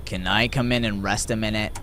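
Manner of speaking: low, tired voice; weary stranger. Acted. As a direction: ask as an exhausted traveler.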